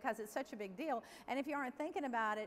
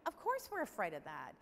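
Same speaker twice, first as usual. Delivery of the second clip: ordinary delivery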